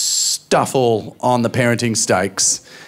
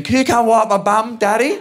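put-on high-pitched little kid voice; small child calling; 8 semitones higher than usual. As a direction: imitate a child calling out for Daddy. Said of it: "My eldest is in".

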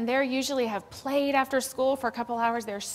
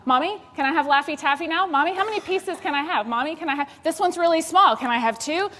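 put-on high, loud pleading child voice; child pestering mommy; whiny kid begging; 6 semitones higher, 6 dB louder than usual. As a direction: beg like a kid for candy. Child begging mom for candy.

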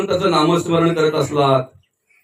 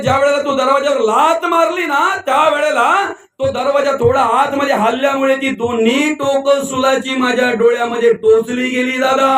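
high-pitched acted anguish; pained complaint; 7 semitones higher than usual. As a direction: plead your agony to the man outside, voice pitched high. Tormented man explains his agony. (वेदनेने कळवळून, चढ्या आवाजात)

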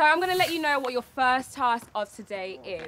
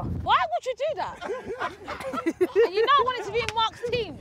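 high, laughing mock complaint; laughing protest; 8 semitones higher than usual. Real, not acted. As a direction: tease them while laughing. Host protests the pick, laughing.

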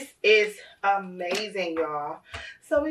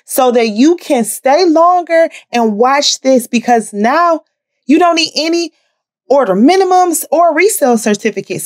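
loud announcement; 10 dB louder than usual. Real, not acted. Loud inserted announcement segment.

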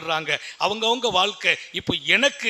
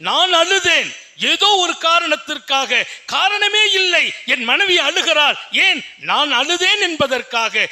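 higher, raised sermon voice; fervent preaching; 7 semitones higher, 4 dB louder than usual. Acted. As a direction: proclaim it to the congregation. Preaching at full force. (உரத்த பிரசங்க குரல்)

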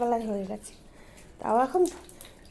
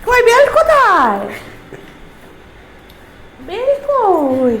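higher, louder, then drawn out slow; mock search; 7 semitones higher, 7 dB louder, slower than usual. Mother faking getting ready for the shop, mock busy. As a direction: act busy looking for the leash. Pretend hunt for the belt.

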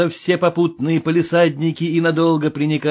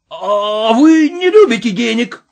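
higher, piping wail; fluting wail; 5 semitones higher, 4 dB louder than usual. Acted. Vorobyaninov wails at Ostap in a piping voice. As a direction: wail it thin like a flute. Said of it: "взвыл Ипполит Матвеевич голосом флейты".

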